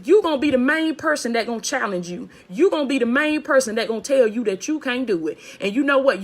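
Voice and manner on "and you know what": normal talking voice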